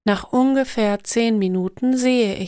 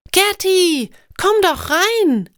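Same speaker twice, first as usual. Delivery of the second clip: higher, louder, slow delighted welcome; joyful loud greeting; 9 semitones higher, 4 dB louder, slower than usual. A narrator's reading. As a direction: mum greeting an old school friend. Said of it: "schreit laut". (freudiger lauter Gruß, höher)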